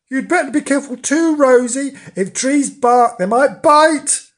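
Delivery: high, urgent kitten voice; alarmed warning. A narrator's reading. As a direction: warn a friend of danger, pitch held high. Frightened kitten warns his friend.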